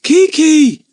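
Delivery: loud, high call; yelling. A narrator's reading. Lucy Ann yelling for Kiki, loud and high. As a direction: shout the name down the tunnel. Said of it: "she yelled".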